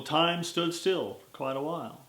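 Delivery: usual speaking voice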